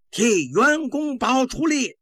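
high, slow, drawn-out officers' cry; straining chorus shout. Acted. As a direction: call it out like a whole rank answering the general. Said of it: "军官们扯着嗓子大声的齐声的回答".

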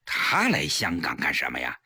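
low, guarded male character voice; deep wary question; suspicious husband. A narrator's reading. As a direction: keep probing, voice dropped.

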